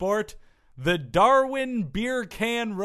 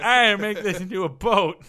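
mock drunk; 3 semitones higher, 4 dB louder than usual. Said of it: "and then be like".

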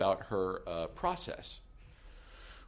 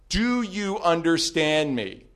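slow, loud demand; stern demand; 6 semitones higher, 9 dB louder, slower than usual. Retold or imitated. Attorney finishing off the stalker, slow stern demand. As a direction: bear down slowly and loud. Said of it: "he goes".

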